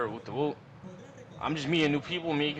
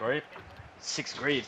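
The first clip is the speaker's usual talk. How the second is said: ordinary talk, nothing marked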